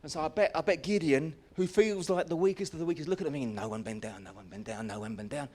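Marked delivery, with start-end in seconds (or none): 3.4-5.5 much lower and softer, repeated under the breath; anxious muttered plea. Acted. Gideon watching the men drink, pleading under his breath. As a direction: pray quietly nobody bends.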